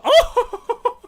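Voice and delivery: high, loud "oh" bursting into laughter; excited laugh. Real, not acted. Gamer seeing a lucky first pull, excited shriek and laugh.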